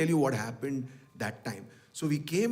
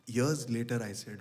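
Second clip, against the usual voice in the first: ordinary talk, nothing marked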